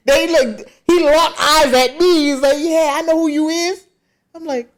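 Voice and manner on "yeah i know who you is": high-pitched mock challenge; confrontational. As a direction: imitate a man calling someone out. Acted confrontation by boyfriend.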